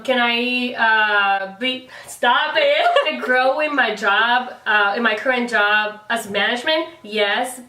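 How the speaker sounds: ordinary talk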